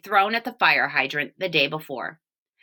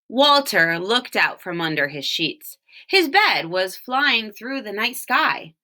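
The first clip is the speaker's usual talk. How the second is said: usual speaking voice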